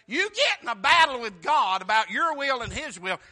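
loud, driving sermon delivery; full-force preaching. Real, not acted. Preacher warning the congregation about fighting God, full-force preaching. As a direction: drive it home to the congregation.

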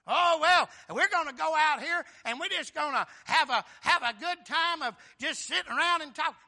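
high, loud carefree declaration; loud mock announcement. Preacher mimicking folks planning just a good time, loud announcement. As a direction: proclaim it loudly as idle plans. Imagined churchgoers announcing idle plans.

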